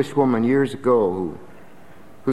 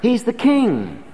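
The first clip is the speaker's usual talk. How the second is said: proclaimed; 10 semitones higher, slower than usual. Shown as a demonstration. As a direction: ring out "He's the king". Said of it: "He's not a merchant".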